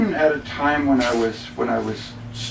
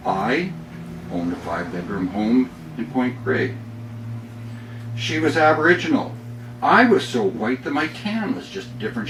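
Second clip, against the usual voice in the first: upbeat poem read with comic timing; humorous poem reading; 3 semitones higher, 3 dB louder than usual. Acted. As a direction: deliver the punchlines with a grin. Reads his humorous poem.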